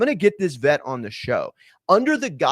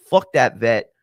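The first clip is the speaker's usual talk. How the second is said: angry outburst; 4 semitones lower, 7 dB louder, slower than usual. Real, not acted. Furious at the vet.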